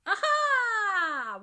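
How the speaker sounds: long, loud, high "aha"; soaring exclamation of delight; delighted discovery. Real, not acted. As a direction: burst out in triumphant recognition. Delighted at a matching request.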